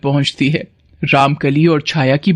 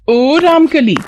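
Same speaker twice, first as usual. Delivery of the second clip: पुकार; 9 semitones higher, 3 dB louder, slower than usual. Acted. पीछे से सहेली को पुकारती है.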